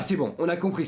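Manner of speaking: ordinary delivery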